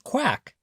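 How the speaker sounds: high quack; duck quack. A narrator's reading. Reading a picture book, a high duck quack. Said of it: "said the duck".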